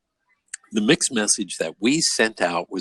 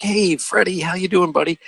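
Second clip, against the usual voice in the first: high, sing-out hello to a dog; friendly dog greeting; 10 semitones higher, 4 dB louder than usual. Retold or imitated. Man greeting his neighbor's dog, high and friendly. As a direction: greet a dog like an old pal, pitched way up.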